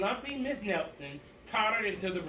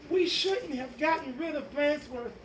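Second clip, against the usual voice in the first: put-on regretful kids; 8 semitones higher than usual.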